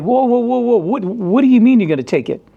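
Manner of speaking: high, loud alarmed whoa; retold disbelief. Telling his coworker off, high startled disbelief. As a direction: stop him in disbelief. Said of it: "I was like".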